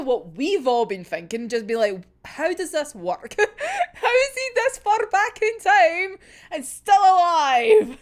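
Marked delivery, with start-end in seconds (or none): 2.2-8.0 laughing disbelief. Retold or imitated. Quoting readers' confused reaction.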